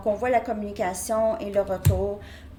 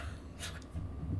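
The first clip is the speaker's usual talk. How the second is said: nervous laugh; 14 dB quieter than usual. Real, not acted. Laughs at own nervous French.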